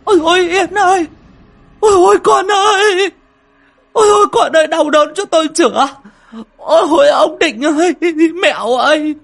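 very high, loud grief-stricken howl; anguished wailing. A narrator's reading. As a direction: wail for help over a dead child, stretching the cries. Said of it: "rồi ôm mặt mà gào lên".

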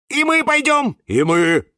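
high, loud cry of volunteers; eager shout. A narrator's reading. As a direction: pipe up loudly to join in. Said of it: "закричали Фикус и Вениамин и Валентин". (громкий высокий выкрик)

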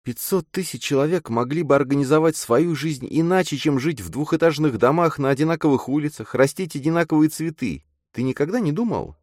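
put-on high, earnest girl's voice; searching girl character. A narrator's reading. Girl asks if her friend ever imagined otherwise, earnest.

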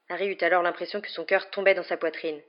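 usual speaking voice

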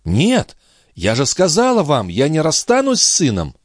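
high-pitched, firm refusal; insistent young mother. Acted. Mother refuses to leave son. (высокий, настойчивый голос матери, отказ)

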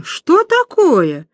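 high, slow, amazed question; drawn-out astonishment; astonished. Acted. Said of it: "Иваницкая изумлённо".

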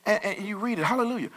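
usual speaking voice

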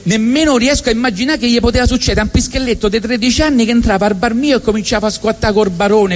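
normal talking voice

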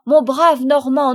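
plus fort, lancé au loin; appelle-le à travers le bois; appel crié. Acted. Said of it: "il cria". (le commandant appelle le Normand caché, en criant)

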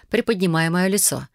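ordinary delivery, nothing marked

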